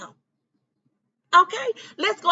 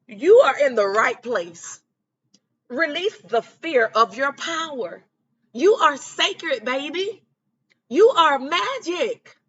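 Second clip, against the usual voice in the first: slow, deliberate reading of an affirmation card; slower than usual. Shown as a demonstration. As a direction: read it slowly, each line landing. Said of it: "I'm gonna read something".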